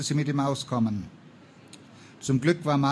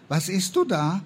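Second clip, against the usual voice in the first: lightly voiced higher young woman; curious question, higher voice; Martha, curious; 5 semitones higher, 3 dB louder than usual. A narrator's reading. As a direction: ask it brightly curious, pitched up. Martha's figure in story.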